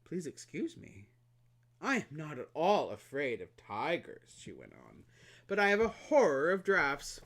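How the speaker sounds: lightly voiced fussy flower; vain flower. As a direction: fret prettily about drafts. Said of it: "the flower".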